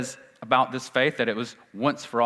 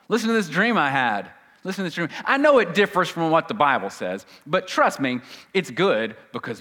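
higher, eager sales pitch, put on; mock dream-teacher; 5 semitones higher, 3 dB louder than usual. False teacher selling his dream to believers, mock-eager. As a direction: push the dream on them, mockingly keen. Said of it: "these men ... keep talking about their dreams".

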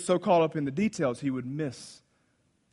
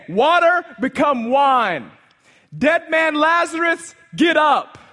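high, loud, slow proclamations; Jesus calling out commands over the dead and the possessed; commanding Jesus; 11 semitones higher, 11 dB louder, slower than usual. Acted. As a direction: command nature with authority.

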